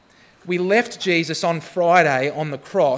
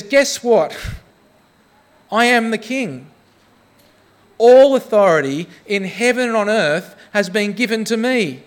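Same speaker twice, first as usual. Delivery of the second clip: higher, louder preacher proclaiming victory; a preacher announcing the risen king, rising and bold; preaching with force; 5 semitones higher, 4 dB louder than usual. Acted.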